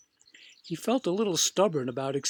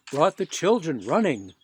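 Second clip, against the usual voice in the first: ordinary delivery, nothing marked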